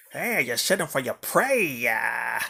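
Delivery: put-on a light Australian hunting accent; mock Australian croc hunter drawl. Coaching listeners, imitating a crocodile hunter's Australian accent. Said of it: "the Australian accent".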